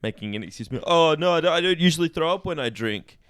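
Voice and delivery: usual speaking voice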